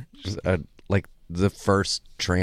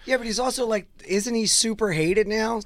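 excited; 7 semitones higher than usual. Real, not acted. Excited outrage about Musk.

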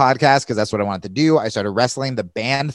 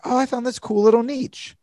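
mock cheerful; 12 semitones higher than usual. Retold naive cheerful thought.

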